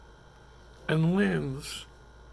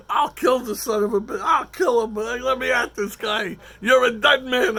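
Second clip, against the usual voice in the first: full shout, much louder and higher; yelling threats; 7 semitones higher, 9 dB louder than usual. Retold or imitated. Comic reliving a bar brawl, shouting death threats. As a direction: roar it as your friends drag you back. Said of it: "I'm yelling at him".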